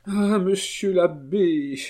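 high, slow lament; despairing. Acted. Marquise confiding in the abbé, high and despairing. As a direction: draw out a despairing sigh of address. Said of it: "avec un accent désespéré".

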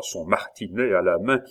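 ordinary talk, nothing marked

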